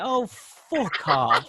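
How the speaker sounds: slow, drawn-out dismissive fuck off; fed-up retort. Retold or imitated. Mock brushing off the London heckler. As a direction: stretch it out, utterly unimpressed. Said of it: "I'm just like".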